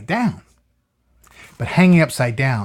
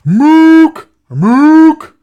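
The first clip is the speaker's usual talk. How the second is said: high, loud honking mook call; mock moose call; 14 dB louder than usual. PJ tries a moose call, a high loud honk. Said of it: "He's going".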